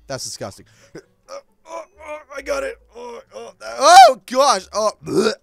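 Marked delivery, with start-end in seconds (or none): 0.9-5.3 grossed-out gagging, swelling to a loud groan; hammed-up queasy retching; disgusted. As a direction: gag and groan as you pick up something revolting. Disgusted picking up llama poop.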